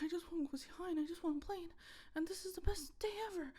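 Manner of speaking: high-pitched excited dog whine; whimpering dog. Acted. Owner voices the dog desperate to play, high whimpering. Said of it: "whimpers, like".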